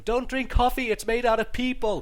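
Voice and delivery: high loud street yell; frantic mock shout at passersby; yelling warning. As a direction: shout it like a street prophet of doom.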